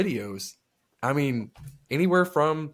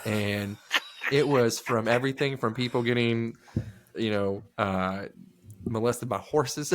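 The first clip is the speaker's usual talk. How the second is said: ordinary delivery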